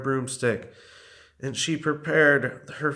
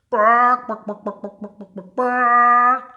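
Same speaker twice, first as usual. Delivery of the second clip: mocking chicken clucks; 8 semitones higher, 6 dB louder than usual. A narrator's reading. Witch taunting dragon with chicken dance, mocking clucks.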